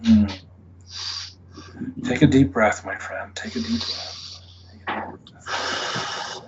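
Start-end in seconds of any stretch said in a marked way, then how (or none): 2.0-6.4 soothing guide. Real, not acted. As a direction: settle him down gently, fading to a breath. Therapist calms frightened client.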